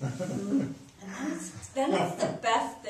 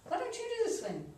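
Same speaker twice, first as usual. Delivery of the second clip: put-on taunting girl; 9 semitones higher, 6 dB quieter, much faster than usual. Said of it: "she looks over at me and she goes".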